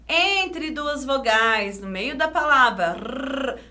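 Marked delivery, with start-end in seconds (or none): 2.9-3.6 "Rr" as a long rolled tongue trill. Shown as a demonstration. Teacher showing one R trembles, trilled. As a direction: keep the R vibrating alone.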